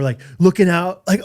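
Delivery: usual speaking voice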